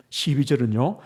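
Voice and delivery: ordinary talk, nothing marked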